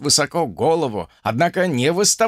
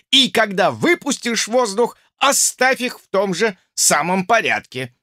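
raised, commanding drill instructor; stern instructor; 5 semitones higher than usual. Acted. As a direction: bark out the lesson like an officer. Drozd instructing cadets, raised voice.

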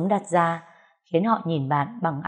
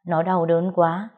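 ordinary delivery

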